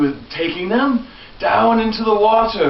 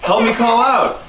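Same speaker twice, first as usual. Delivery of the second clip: ordinary delivery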